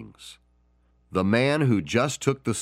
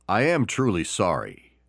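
usual speaking voice

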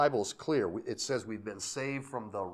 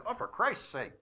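high, mock-exasperated "Oh, for Christ's sake"; exasperated bystander; 9 semitones higher than usual. Preacher imitating people's careless swearing, mock exasperation. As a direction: toss it off like an annoyed passerby. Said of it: "sometimes people say".

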